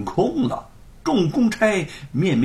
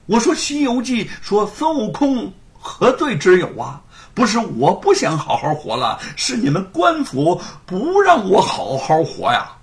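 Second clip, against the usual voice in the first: indignant, raised and louder; angry retort; 6 semitones higher, 5 dB louder than usual. A narrator's reading. Storyteller answering the constable: indignant retort. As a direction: fire back in anger. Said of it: "怒色涌起".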